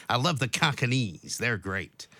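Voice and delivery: put-on drawn-out American accent, lightly sent up; mock American mafia guy. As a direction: imitate an American mangling Cockneys as cack-a-neez. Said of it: "pronounces it all wrong".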